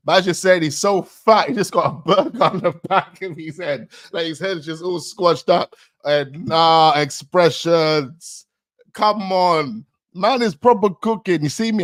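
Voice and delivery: loud, high, animated rant; selling how savage the bar is; real excited reaction; hyped retelling. Real, not acted.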